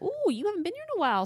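high, fast mock receptionist greeting; chirpy receptionist. Imitating the dental office staff greeting a returning patient, high and fast. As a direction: play the dentist's front desk, chirping it. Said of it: "And they're like".

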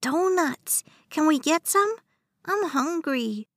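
put-on high, far child voice asking for donuts; eager little boy. A narrator's reading. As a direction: play the eager hungry kid.

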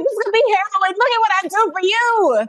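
high, put-on fake-generous voice; imitating Misty bragging about what she did for Natalie, high and put-on; mocking Misty. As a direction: play it like a self-satisfied martyr. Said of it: "she's like".

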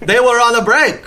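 high, loud hammed-up sitcom quote; Friends sitcom voice. Playfully quoting Friends for the hosts, in a loud, high, hammed-up sitcom voice. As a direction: belt it out like a TV sitcom character. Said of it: "what country is that accent from that you're doing".

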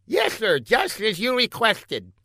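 high-voiced obedient lackey; meek scientist underling. A narrator's reading. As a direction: answer the boss in a high, servile voice.

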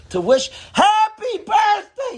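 high, strained shout over a noisy room. Retold or imitated. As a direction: yell it across a crowded dining room. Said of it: "trying on top of your lungs".

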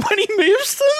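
ordinary talk, nothing marked

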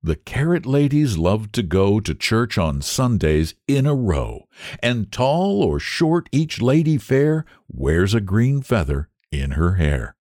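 ordinary delivery

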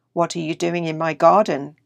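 loud, lightly voiced gruff challenge; big gruff voice. A narrator's reading. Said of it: "a big gruff voice said".

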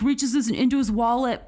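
ordinary delivery

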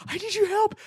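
very high squealed plea; upset kid. Retold or imitated. Player imitates the kid calling his friend, high upset plea. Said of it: "He literally was, like, crying".

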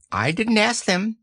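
high, offhand rabbit voice; breezy disclaimer; Rabbit, careless. Acted. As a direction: shrug off any blame, pitched high. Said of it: "explained Rabbit carelessly".

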